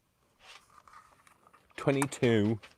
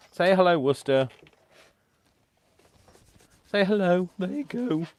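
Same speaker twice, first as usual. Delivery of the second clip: high, loud pet-coaxing voice; talking to a dog; 13 semitones higher, 9 dB louder than usual. Real, not acted. Owner asking Worcester to say hello, high coaxing. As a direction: coax the dog in a high sing-out voice.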